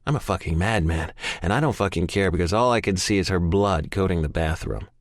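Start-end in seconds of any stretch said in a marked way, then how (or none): none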